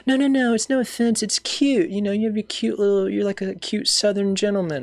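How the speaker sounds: high-pitched mock female friend voice; imitated reassuring friend. Friend explaining no offense meant, high imitated voice.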